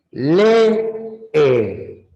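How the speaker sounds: le, e split into two syllables. Shown as a demonstration. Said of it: "sílabas distintas". (separa le y e en dos sílabas)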